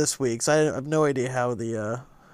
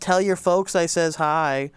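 put-on Wisconsin accent; 3 semitones higher, 4 dB louder, slower than usual. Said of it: "Wisconsin impressions".

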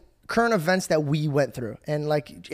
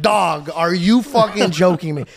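loud, slow, stunned question; amazed disbelief; 5 dB louder, slower than usual. Retold or imitated. Retold amazement at his friend.

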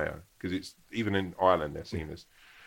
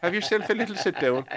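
high, loud cheery landlady; landlady's sing-song greeting; 14 semitones higher, 6 dB louder than usual. Retold or imitated. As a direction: call it out brightly and high like the landlady. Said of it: "It's like".

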